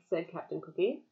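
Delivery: ordinary delivery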